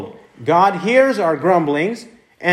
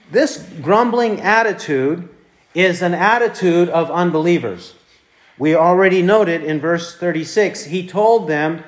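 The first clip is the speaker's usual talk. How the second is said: ordinary talk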